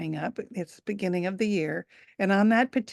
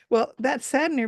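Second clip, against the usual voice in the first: usual speaking voice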